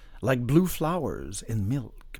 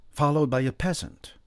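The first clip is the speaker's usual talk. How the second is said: ordinary delivery, nothing marked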